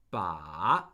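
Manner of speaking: Ba dipping very low, then rising; third tone on Ba. Shown as a demonstration. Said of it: "The third tone is a falling rising tone".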